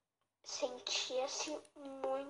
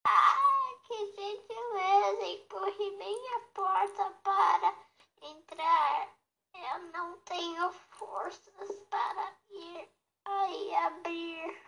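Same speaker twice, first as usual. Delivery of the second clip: grandma voice; 6 semitones higher, 6 dB louder than usual. Acted. Weak grandmother answers from bed.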